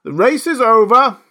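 sudden high, loud announcement; calling out. A narrator's reading. Dodo ending the race, calling out. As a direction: call it out to all the runners. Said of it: "the dodo suddenly called out".

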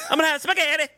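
very high mock Italian accent; hammed-up Italian accent; huge put-on accent. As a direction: squeal it in a cartoon Italian accent. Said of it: "put this huge accent on the one word".

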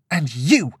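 heated, accusing outburst; turning ire. A narrator's reading. Girl turning on her friend, accusing anger. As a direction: round on them angrily. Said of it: "Lillian turned her ire towards her friend".

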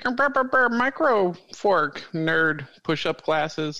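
high-pitched nerdy mumble sliding back down; high mock-nerd gibberish. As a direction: imitate a nerd fussing in a squeaky voice. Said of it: "you're a nerd".

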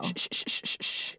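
soft unvoiced hushing; soothing shush. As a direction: shush her gently. Hushing her.

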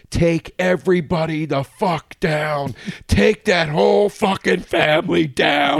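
emphatic, drawn-out mock tirade; Michael Rappaport. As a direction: mimic a public rant, slow and forceful. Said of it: "he basically publicly was like".

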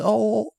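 high, rounded put-on moan; mock climax "oh". Shown as a demonstration. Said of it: "any difference in the".

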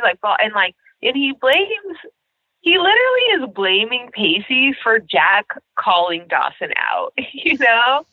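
ordinary talk

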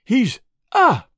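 sudden shriek of fright; shriek of terror. A narrator's reading. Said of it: "With a shriek of terror".

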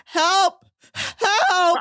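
high, drawn cry for help; hammed-up "save me" cry; mock plea for help. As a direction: imitate a helpless wail.

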